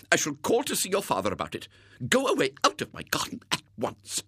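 high, quick indignant woman; stern lady captor. A narrator's reading. Captor threatens to tell William's father, sternly indignant.

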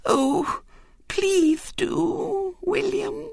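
put-on very high, breathless little-girl pleading; quivering plea. A narrator's reading. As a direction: beg as if tears are coming. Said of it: "in a quivering voice".

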